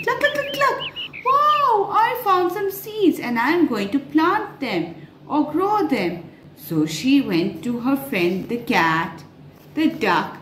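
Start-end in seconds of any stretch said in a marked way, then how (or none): 0.0-0.8 high, bright cluck cluck cluck; hen clucking. A narrator's reading. Narrator clucking as the happy hen. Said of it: "she started clucking". 1.2-6.2 high, delighted little hen; excited hen. Acted. Hen telling the children she found seeds, thrilled. Happy hen voiced in story.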